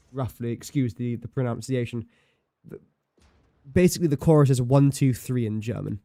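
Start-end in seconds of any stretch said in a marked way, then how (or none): none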